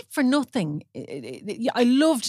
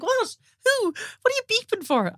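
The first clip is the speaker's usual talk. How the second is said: high, exasperated retold outburst, pitched up; mock indignant; 12 semitones higher than usual. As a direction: imitate her annoyed self at the honking. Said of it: "I'm like".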